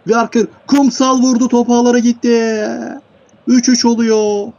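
loud, very high, sustained goal cry; excited goal shout. Real, not acted. Commentator announces three-all as Kumsal scores, excited shout. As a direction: shout the equaliser, stretching the last words.

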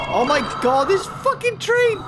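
high-pitched frantic outburst; exasperated cry. Real, not acted. Player cursing the demon train, exasperated. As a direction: let the exasperation shoot your pitch up.

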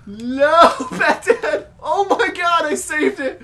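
high, loud frantic cheer; excited yelling. Real, not acted. Player celebrates own save, high excited shouting. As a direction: yell in amazed excitement.

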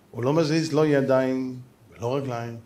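ordinary talk, nothing marked

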